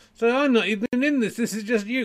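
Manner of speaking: high-pitched puppet protest; complaining puppet. Pretend. Puppet complains it is not in this, high protesting voice.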